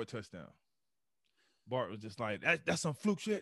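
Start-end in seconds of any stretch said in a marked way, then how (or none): 2.4-3.4 higher, scoffing dismissal; mocking trash talk. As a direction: sneer it off as luck.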